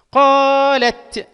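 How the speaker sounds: loud, high, long "قا" in "قالت"; very slowly stretch "قالت"; drawn-out long vowel. Shown as a demonstration. Teacher analysing the word for students, drawn-out long vowel. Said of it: "مد مع الممدود".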